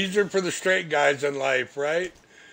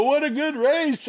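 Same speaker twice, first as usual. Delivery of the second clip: cheerful quote; 11 semitones higher, 6 dB louder than usual. Retold or imitated. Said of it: "we come in there, go".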